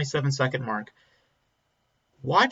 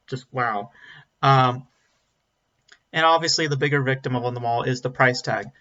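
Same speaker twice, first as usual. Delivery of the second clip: usual speaking voice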